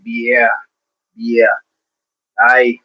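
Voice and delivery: lightly put-on Aussie accent. Shown as a demonstration. Host showing chat how Aussies talk, mock Aussie accent. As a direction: try a low, easy Aussie accent. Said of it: "a strong Aussie accent".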